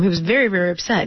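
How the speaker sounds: ordinary talk, nothing marked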